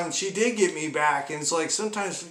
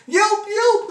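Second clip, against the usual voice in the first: dog yelp imitation; 8 dB louder than usual. Dog yelping when cut.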